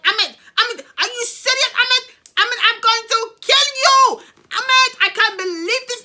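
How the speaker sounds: high, loud mock screeching at a husband; imitating enraged wife. Voicing wife raging at husband.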